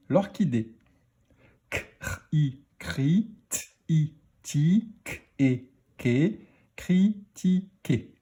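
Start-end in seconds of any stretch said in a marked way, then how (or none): 1.7-8.1 critiqué broken into cri, ti, qué; slow letter sounds blended into separate syllables; sounded out. Shown as a demonstration. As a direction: decode critiqué piece by piece for learners. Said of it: "On y va pour la lecture des mots".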